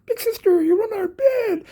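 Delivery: high, sweet little-brother cat voice, slowed; a kitten gushing over his big sister; squeaky cat brother, pleased and eager; voicing the cat brother. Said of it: "one of her brothers ... is going".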